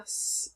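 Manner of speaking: "s" as a bare hiss, not the letter name; isolated s sound. Shown as a demonstration. Teacher shows the sound ending rinse, isolated "s". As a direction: hiss "s" alone with no vowel. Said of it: "making the s sound".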